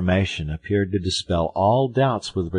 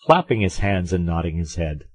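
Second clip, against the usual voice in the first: usual speaking voice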